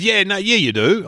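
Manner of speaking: high, loud insistent bloke voice; imitating a bloke. A stranger insisting the comedian works there, high and loud. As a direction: push back like a pushy stranger.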